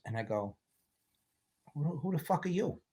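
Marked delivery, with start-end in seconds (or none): 1.7-2.8 softly put-on mob boss menace; quiet, mock-suspicious challenge to a stranger; Marlon Brando Godfather. Boss greets the helper at morning coffee, softly menacing Godfather bit. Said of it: "Marlon Brando Godfather bit".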